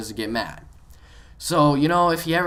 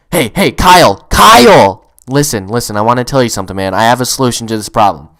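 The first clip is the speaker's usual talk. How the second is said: very loud, right on the microphone; close to mic; 4 semitones lower, 14 dB louder than usual. Retold or imitated. Gamer getting Kyle's attention over voice chat, loud close-mic voice. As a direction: boom the name, then press on lower. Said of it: "I just get really close to my mic like this".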